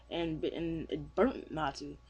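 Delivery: ordinary delivery, nothing marked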